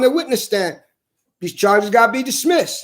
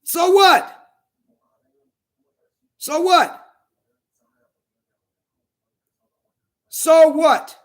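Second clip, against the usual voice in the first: high-pitched, angry outbursts; defiant challenge; 5 semitones higher, much slower than usual. Real, not acted. Man dismissing the accusations, defiant challenge. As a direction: fling it out between long silences.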